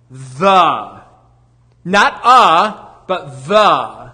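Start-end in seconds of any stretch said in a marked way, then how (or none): 1.9-4.1 "a" punched louder, then "the" set apart; stressed contrast. Acted. Preacher correcting the article, stressed contrast. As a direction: spell out the difference between "a" and "the".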